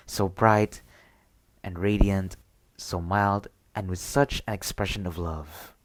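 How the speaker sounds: ordinary delivery, nothing marked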